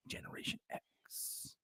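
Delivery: breathy whispered aside. Real, not acted. Whispering the name of his own generation. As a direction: hush it like a secret name. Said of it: "whispered aside".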